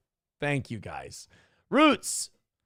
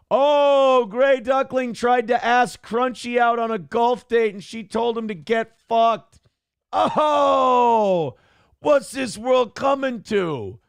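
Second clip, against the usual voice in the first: mock outrage; 6 semitones higher, slower than usual. Real, not acted. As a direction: shout it like a scandalized gossip. Host mock outraged at chat.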